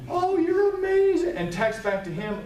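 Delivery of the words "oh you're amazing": much higher, louder gushing wife; delighted wife. Retold or imitated. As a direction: gush like a thrilled wife. Wife's delighted reading, voiced.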